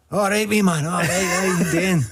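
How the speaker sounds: a chirpy Glasgow lad, slowed right down; Scottish fan voice. Retold or imitated. Scottish fan greets the footballer on the beach, chirpy and high.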